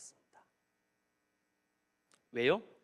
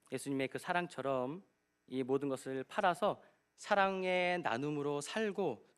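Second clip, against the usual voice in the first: usual speaking voice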